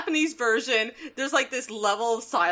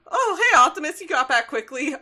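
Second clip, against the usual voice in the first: high, louder mock greeting; acted cheery greeting; 5 semitones higher, 6 dB louder than usual. Ratchet greets Optimus on his quick return, mock cheery greeting. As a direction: chirp it as an impromptu character line.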